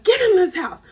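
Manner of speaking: high, loud imitation of an angry mom; high motherly scolding; mama yelling. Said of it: "like, yelling. Yelling".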